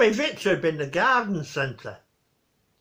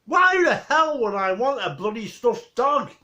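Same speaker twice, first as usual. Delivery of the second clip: exasperated Victor outburst, pitched up; Victor losing it; 6 semitones higher, 3 dB louder than usual. Retold or imitated. Telling off Mrs Warboys over a stuffed dog, exasperated Victor voice. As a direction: snap it out like a fed-up grumpy old man. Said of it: "He says".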